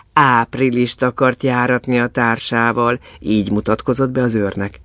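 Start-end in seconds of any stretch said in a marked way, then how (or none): none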